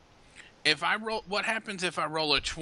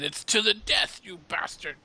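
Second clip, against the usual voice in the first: mock-defiant cry, higher at first; pitched-up battle cry that drops away; defiant fighter; 7 semitones higher than usual. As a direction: throw out a mildly hammed-up last challenge. Acting a beaten fighter's last words.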